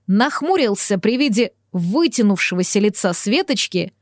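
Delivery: usual speaking voice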